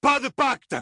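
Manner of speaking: rugi très haut et très fort; rugissement furieux. Acted. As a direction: rugir le refus à Taïm, hors de lui. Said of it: "rugit-il". (Rand rugit de fureur face à Taïm)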